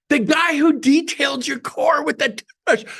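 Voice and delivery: high, strained mock holler; insistent calling out. Receptionist reminds coworker of the car detailer, mock insistent holler. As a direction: imitate an exasperated reminder across the office. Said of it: "She muffles the phone, but I could still hear".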